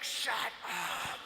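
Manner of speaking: low demonic growl of shut up; exorcist voice. Retold or imitated. Annoyed girl snapping at a friend, low demonic growl. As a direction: snarl it like a possessed girl. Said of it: "your exorcist voice is going really hard".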